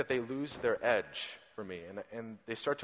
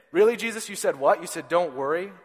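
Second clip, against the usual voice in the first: loud, high mock disbelief; really, you said that?; incredulous; 3 semitones higher, 5 dB louder than usual. Preacher talking back to Jesus, loud incredulous.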